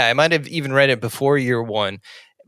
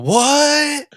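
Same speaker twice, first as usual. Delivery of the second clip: shocked; 12 semitones higher than usual. Retold or imitated. Batman's stunned reaction to orphan line.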